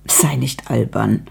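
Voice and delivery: hushed, breathy dismissal with a nervous edge; nervous whisper, half laughing it away. Acted. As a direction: brush it off under your breath.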